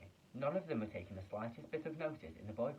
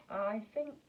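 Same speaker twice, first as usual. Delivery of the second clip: ordinary delivery, nothing marked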